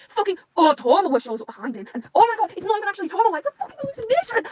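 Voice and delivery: loud, high outraged rant; furious rant. Real, not acted. Reader to viewers, raging at the villain. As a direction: vent at the villain appearing. Angry at hated character.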